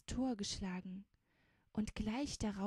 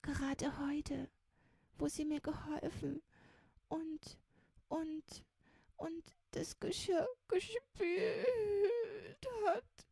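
put-on high, halting tearful child voice; sobbing; 9 semitones higher than usual. A narrator's reading. Child weeps over Mimutz's fate, halting high sobs. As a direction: stammer through tears, climbing into a squeaky cry. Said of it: "Fingerspitzchen schluchzte auf".

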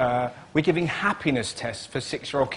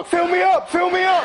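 put-on very high, loud childish cry; excited child; 13 semitones higher, 6 dB louder than usual. Little brother begging eagerly, squealing high and loud. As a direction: squeal it like an eager six-year-old.

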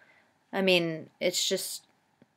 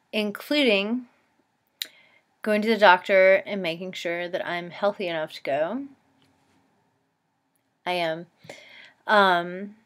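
ordinary delivery

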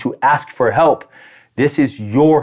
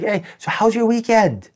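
high, slow, sing-song small talk; change the subject with put-on lightness; breezy deflection; 9 semitones higher than usual. To a gossiping coworker, diverting the energy, breezy.

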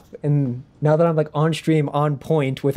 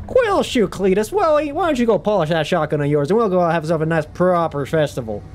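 put-on Southern accent; 3 semitones higher than usual. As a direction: holler neighbourly in Southern American. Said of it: "English is one I definitely like".

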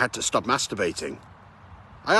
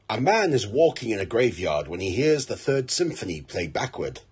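ordinary delivery

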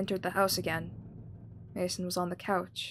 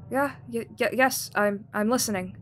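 nervous stammer; 4 semitones higher, 6 dB louder than usual. Acted. Startled mother stammering on phone.

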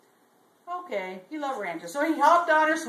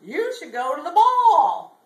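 loud, higher, drawn-out call; mysterious voice; 4 semitones higher, 10 dB louder than usual. Acted. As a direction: call it out like a mystery voice, stretching the last word. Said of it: "he heard the voice again".

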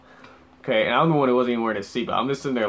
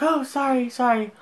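high-pitched mock girl apologizing; mocking falsetto sorry; flustered apology; 4 dB louder than usual. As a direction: imitate a panicky driver in squeaky falsetto. Mimics flustered driver apologizing.

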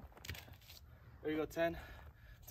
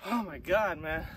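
winded; 7 dB louder, slower than usual. Real, not acted. After a hard set.

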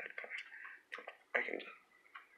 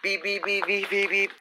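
high robotic beeping; 10 semitones higher than usual. Pretend. Imitating toy beeps.

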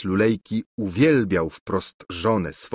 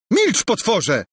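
much higher, louder furious scream; 13 semitones higher, 5 dB louder, slower than usual. Acted. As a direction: shriek at him to shut up. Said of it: "wrzasnęła Angelika".

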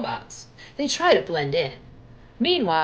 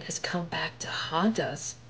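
whispered ghost warning; spooky whisper; 3 semitones lower, 7 dB quieter than usual. Acted. Cousin warning the gang about the ghost, in a whisper. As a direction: hush it like a campfire tale.

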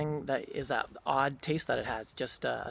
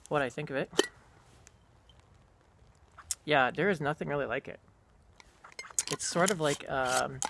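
talking around pipe stem; pipe in mouth; 5 dB louder than usual. Real, not acted. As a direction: teeth clenched on pipe. Seen 0: Pipe stem clenched in teeth throughout while talking and sniffing jar.

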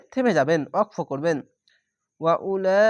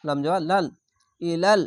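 ordinary talk, nothing marked

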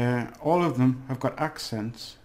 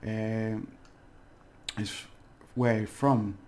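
normal talking voice

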